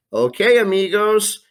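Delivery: high, bright, loud goodbye with a long drawn-out "Ciao"; beaming farewell to students; cheery sign-off. Real, not acted. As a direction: sing out the goodbye to the class.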